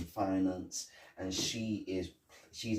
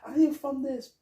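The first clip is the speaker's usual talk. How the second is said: high, fast put-on official voice; mock inspector barking orders; bossy inspector. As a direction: order the workers around in a squeaky fake authority. Imitating Foley bossing workers.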